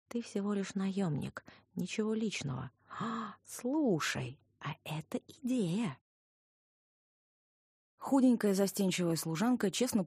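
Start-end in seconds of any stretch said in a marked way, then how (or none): 0.1-6.0 slow, thoughtful repetition; slowly dawning realization; slowly repeating. Acted. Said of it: "медленно повторила я". (медленно, задумчиво повторяя)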